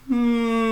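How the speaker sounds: a high, sustained hum; slow rolling hum. Shown as a demonstration. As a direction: roll the hum slowly around the neck. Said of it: "very slowly and mindfully".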